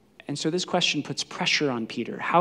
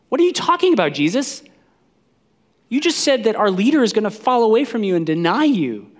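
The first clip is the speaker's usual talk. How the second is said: high, loud bewildered challenge; incredulous protest; 8 semitones higher, 6 dB louder than usual. Acted. Disciples challenging Jesus over Peter's denial, loud and incredulous.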